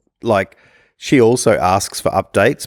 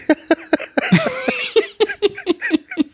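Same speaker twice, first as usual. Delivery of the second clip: long high laughter; a long, high, helpless laugh. Real, not acted. Laughs at own impatience.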